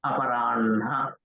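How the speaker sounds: aparanha with n held, then ha released from the chest; correct nasal-h order. Shown as a demonstration. Teacher gives an example of ha plus na, carefully pronounced. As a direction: say "aparanha", n running into a breathed ha. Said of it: "it is not aparahana, it is aparanha".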